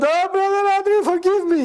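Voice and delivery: imitated crying. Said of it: "And I start crying".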